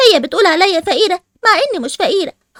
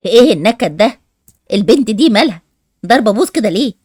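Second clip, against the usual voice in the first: much lower, mocking teenage boy; deep boy voice; 11 semitones lower than usual. A brother teasing his sulky cousin, deep mocking voice. Boy Ali mocks Malak.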